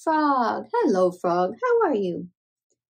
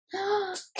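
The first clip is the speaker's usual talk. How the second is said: gasp; 8 semitones higher, 7 dB quieter than usual. Real, not acted. Race finish.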